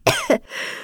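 high, frail coughing; weak old cough. Acted. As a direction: cough like a sick old woman. Sick grandmother coughs.

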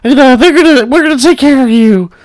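high whiny mock voice. Mimicking haters threatening to call his teams, high and whiny. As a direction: squeak out the threat like a petty critic. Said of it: "some people are like".

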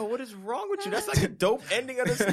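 high, loud incredulous mock rant; teasing a friend for crying at a happy ending, incredulous; mock exasperation. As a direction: act baffled at a crying friend. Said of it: "And I'm like".

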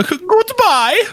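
high, slow, laughing goodbye; pig voice. Acted. Seeing off the pretend pig guest in a high, slow voice. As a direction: bid farewell in the squeaky pig voice.

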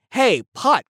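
ordinary talk, nothing marked

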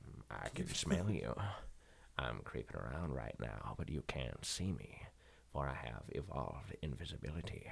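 menacing Morgan Freeman hunting his friend; deep slow Freeman drawl, calm menace; Morgan Freeman impression. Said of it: "pretend to be Morgan Freeman".

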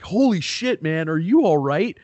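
high, loud burst of alarm; startled shout. Retold or imitated. Neighbor running out to the crash, alarmed shout. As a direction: shout like a stranger running out to help. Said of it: "He's like".

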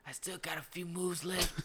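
put-on a battered old man still bluffing; creaky old hero. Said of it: "he's just like".

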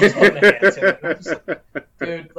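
high, loud laughing retelling. Real, not acted. Laughing while retelling bar back.

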